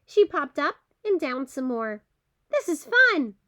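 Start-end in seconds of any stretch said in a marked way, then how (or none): 2.5-3.4 delighted. Acted. Squirrel popping up and down.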